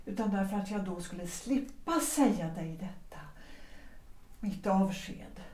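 unvoiced whisper, hushed; wife whispering a painful confession to her husband. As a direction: breathe it out as a whisper, ashamed. Wife confesses death wish.

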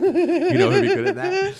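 squealing with eager laughter; excited pitch. Retold or imitated. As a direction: beg him to cast your pick, giggling. Pitching a casting idea to teacher.

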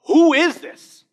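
high, loud astonished question; awed disbelief. Retold or imitated. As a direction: gasp out the question in awe. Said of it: "they're like".

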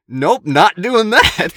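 slow, high, very loud refusal; emphatic refusal. Retold or imitated. On shrooms facing the porta potty door, loud comic refusal. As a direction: shout it drawn out, acting out alarm.

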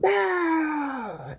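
high squeal; germ's high yelp. Acted. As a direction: cry out as hit. Germ shot.

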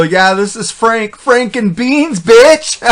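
loud, high, drawn-out cocky comeback; cocky prank-call answer. Pretend. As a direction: crow it slowly, rising to a loud punch. Suggested cheeky prank-call answer.

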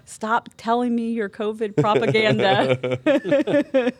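hammed-up exasperated feedback; mock complaint. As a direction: spell out the complaint with hammed-up indignation. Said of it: "submit feedback".

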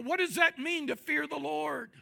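ordinary talk

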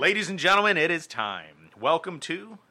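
big slow show-host intro; announcer voice. Acted. Opening the show's conspiracy segment.

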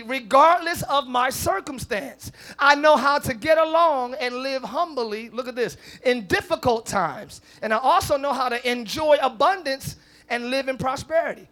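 emphatic scripture reading. Acted. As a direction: proclaim the verse with rising force. Preacher reading scripture with force.

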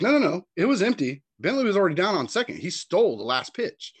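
high, loud protest. Retold or imitated. Coaches protesting the umpire's call. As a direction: argue it back hard. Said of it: "And we're like".

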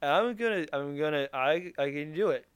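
put-on slurred drunken bragging. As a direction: slur it like a drunk. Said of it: "that's, that's my drunk voice".

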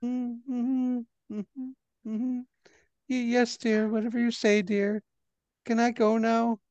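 put-on an old woman humoring someone she fears; terrified placating. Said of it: "She is very afraid of you".

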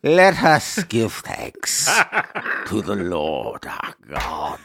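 slow, drawn-out liturgy; cathedral echo. Acted. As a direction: intone like in a big church. Mock liturgy.

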